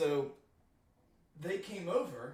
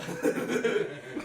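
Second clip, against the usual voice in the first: breathy burst of laughter; laughing; 6 semitones higher, 9 dB louder than usual. Real, not acted. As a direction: laugh it out, unvoiced. Laughs at own joke.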